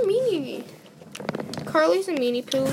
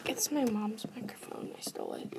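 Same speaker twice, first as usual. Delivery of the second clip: soft unvoiced secret whisper; whispering a secret to her friend; confessing whisper; 14 dB quieter than usual. Real, not acted. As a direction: hush it like a confession. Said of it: "secrets admitted".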